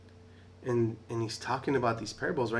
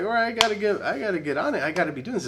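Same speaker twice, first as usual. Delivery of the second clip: fired-up resolve; 9 semitones higher, 7 dB louder, faster than usual. Retold or imitated. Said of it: "You're like".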